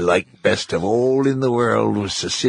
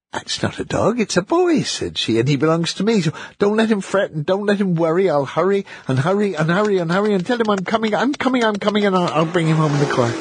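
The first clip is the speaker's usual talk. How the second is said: high, rushed, excited mother; flustered mother; 8 semitones higher, faster than usual. A narrator's reading. Mother tells the pound man she's coming, high and hurried. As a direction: hurry it out, high and breathless. Mother claims her son, hurrying.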